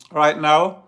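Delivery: talking while crunching a mint; words around a crunching mint; crunching a peppermint. Real, not acted. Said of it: "sorry, I'm crunching a peppermint here".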